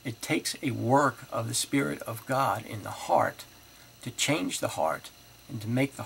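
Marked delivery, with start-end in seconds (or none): none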